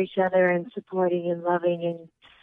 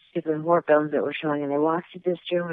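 usual speaking voice

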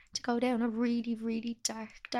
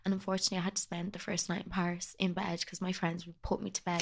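normal talking voice

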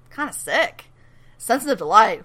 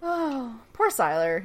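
ordinary talk, nothing marked

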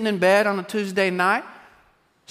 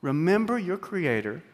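solemn scripture reading; 5 semitones lower, 9 dB quieter than usual. Real, not acted. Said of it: "reading scripture".

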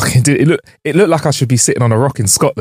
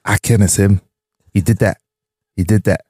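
put-on toddler voice. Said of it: "Why do you sound like a toddler?".

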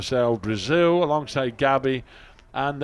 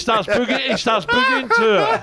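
high, loud, laughing through the words; can barely get it out for laughing; laughing hard; 11 semitones higher, 3 dB louder than usual. Real, not acted. Cracking up describing a mate dancing to the music.

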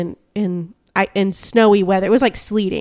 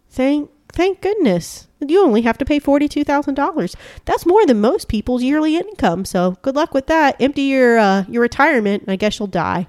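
sarcastic; 6 semitones higher than usual. Acted. Sneering at a hospital bill to the cohost, sarcastic. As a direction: fake cheer that curdles into sarcasm.

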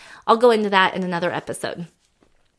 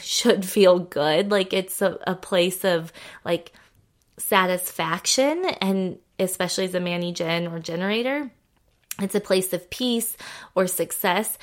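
ordinary talk, nothing marked

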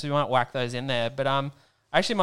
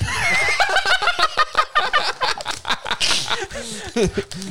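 loud laugh; 5 dB louder than usual. Real, not acted. Laughs at overseas joke.

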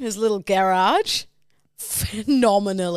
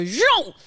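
higher, punchy spoken zoom; car zoom noise; 7 semitones higher, 3 dB louder than usual. Retold or imitated. Bragging about fast parking, car zoom noise. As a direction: imitate the car whooshing in. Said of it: "I just go".

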